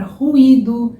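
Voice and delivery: ruído with an h-like R at the start; strong R sound. Shown as a demonstration. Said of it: "Ele vai ter este som, que é um som mais forte".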